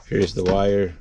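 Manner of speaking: normal talking voice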